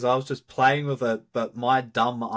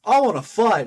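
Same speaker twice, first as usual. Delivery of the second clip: high, loud Goku impression, pitched up; hammed-up Goku craving a fight; 10 semitones higher, 7 dB louder than usual. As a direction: belt it like an eager Saiyan. Quoting Goku, who only wants to fight.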